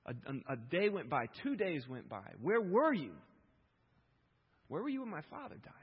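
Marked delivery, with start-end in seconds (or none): 2.4-5.7 raised, pleading question, pitched up; a touch of a grieving cry. Acted. Mourner asking God about a dead father, raised plea. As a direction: press the question to God in mock grief.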